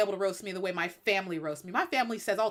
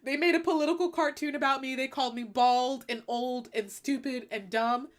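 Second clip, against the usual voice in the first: mock crying; 3 semitones higher than usual. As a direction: blubber like a wounded president, easing off.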